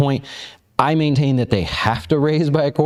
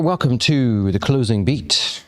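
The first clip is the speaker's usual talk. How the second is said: high, bright host greeting, slowed down; upbeat show opener; 8 semitones higher than usual. Acted. Host welcoming viewers to the closing beat, upbeat and slow. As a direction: hype up the viewers as the show kicks off.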